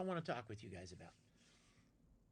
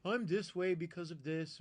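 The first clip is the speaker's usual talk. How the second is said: mock excuse-maker; 3 semitones higher, 5 dB louder than usual. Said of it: "or say".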